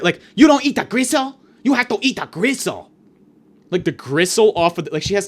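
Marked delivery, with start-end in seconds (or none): 0.3-2.9 high, raised voice imitating a pushy waitress; mock in-your-face scolding; screaming waitress. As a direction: scold the diner loudly, easing off slightly. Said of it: "Screaming in her face".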